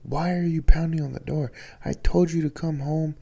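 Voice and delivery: exasperated grandmother scolding her son. Retold or imitated. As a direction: tell him off like an exasperated abuela. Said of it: "She's starting to scold him".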